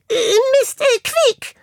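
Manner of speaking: very high, squeaky fumbled cover-up; blunder, then squeak like an animal; flustered hamster. A narrator's reading. Hamster realizing he spoke to the boy, flustered squeak.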